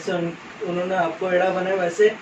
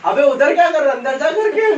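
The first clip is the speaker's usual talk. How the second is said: mock yell; 6 semitones higher, 4 dB louder than usual. Uncle shouting from below.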